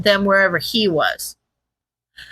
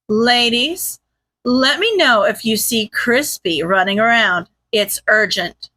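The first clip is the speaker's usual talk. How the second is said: plain reading voice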